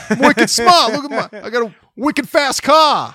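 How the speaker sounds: put-on cocky Boston accent, bragging. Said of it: "Like a Boston Townie".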